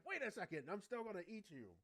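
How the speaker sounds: lightly hammed-up monster objection; mock-angry Minotaur. Minotaur threatening to eat Goku, mock-angry.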